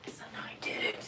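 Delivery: unvoiced whisper. Real, not acted. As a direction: whisper it under your breath. Unvoiced aside.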